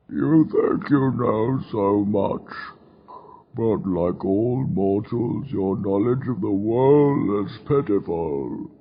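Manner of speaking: slow, low, mournful demon voice. A narrator's reading. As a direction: lament mortal ignorance slowly. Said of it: "the low, mournful tone".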